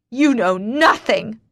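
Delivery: slow, loud angry yell; furious outburst; scream. Acted. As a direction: scream it at him. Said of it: "I scream".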